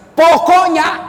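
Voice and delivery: imitated wife shouting Pokoknya; nagging wife. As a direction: bark it like a stubborn wife ending the debate. Wife insisting in an argument.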